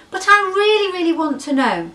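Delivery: high, loud begging; high insistent pleading; pleading calf. Acted. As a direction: beg like a small child. Pleading baby elephant.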